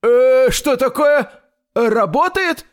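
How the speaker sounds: high, startled tailor's voice; a loud, high, drawn-out start of surprise; surprised mumbling. A narrator's reading. Tailor startled by grandfather's sudden arrival, surprised mumbling. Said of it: "промычал портной, удивлённый внезапным появлением дедушки".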